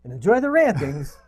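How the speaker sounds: showy handover. Pretend. Host introducing the co-host's rantings, showy announcing. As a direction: announce the segment with big, projected flourish. Handing over the show with flourish.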